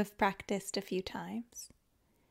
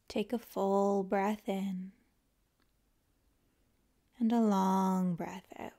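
calm, unhurried yoga nidra guidance with long pauses; guided meditation; 3 dB quieter than usual. Acted. Teacher guiding listeners to let the body melt, calm guided meditation. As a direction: ease them into rest, leaving space after each phrase.

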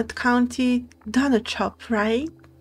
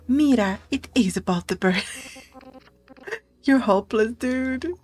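usual speaking voice

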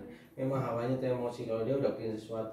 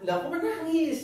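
laughing tease. Real, not acted. Laughing at crying child.

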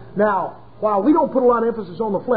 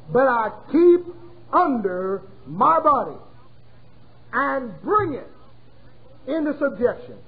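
scripture reading. Acted. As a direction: read the verse like holy writ. Reads scripture aloud.

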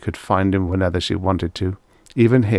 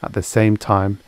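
usual speaking voice

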